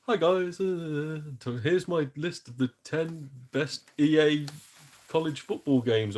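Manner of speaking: friendly YouTuber impression. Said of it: "his sort of friendly persona".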